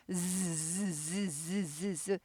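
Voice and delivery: 즈 drawn out as a long buzzing z; 즈 held like a bee's buzz. Shown as a demonstration. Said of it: "벌들이 붕붕거리는 소리".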